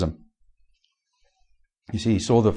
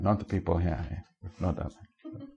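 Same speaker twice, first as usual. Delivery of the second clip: softer, dry ironic aside; ironic hush; 4 semitones lower, 12 dB quieter than usual. Real, not acted. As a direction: lower and much softer, tongue in cheek.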